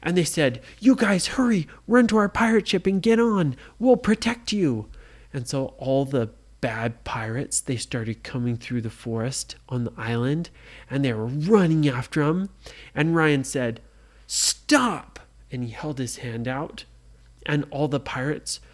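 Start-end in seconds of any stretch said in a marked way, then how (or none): 0.8-4.9 loud, raised urgent voice; urgent call. A narrator's reading. Kids urging the prisoners to run, loud and urgent. Heroes urging others to flee. 14.3-15.2 high firm command; commanding shout. A narrator's reading. Ryan halting the pirates, commanding shout.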